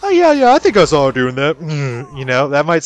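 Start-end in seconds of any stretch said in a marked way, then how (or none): 0.0-1.6 high, loud mock sarcasm; overdone sarcastic oh yeah. Shown as a demonstration. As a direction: drip with fake agreement, way up high. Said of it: "might to others sound like sarcasm".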